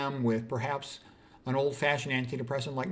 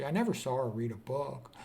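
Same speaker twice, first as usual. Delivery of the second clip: usual speaking voice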